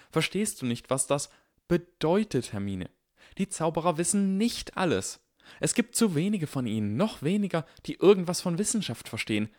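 high, urgent persuasion; excited urging. Acted. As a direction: push hard, eager.

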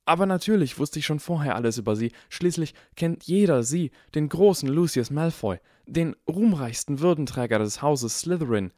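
high, gushing fan chatter; hurried babbling. A narrator's reading. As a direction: pour out flattery without stopping. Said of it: "so schnell wie möglich".

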